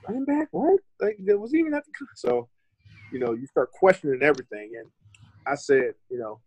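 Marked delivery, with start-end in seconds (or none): 0.1-1.8 high incredulous mock disbelief. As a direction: retell the shock in a raised voice. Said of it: "I was like".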